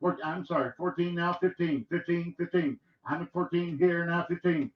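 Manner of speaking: rapid rhythmic auction chant; auctioneer. Acted. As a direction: roll the numbers like a bid caller. Auction bid calling.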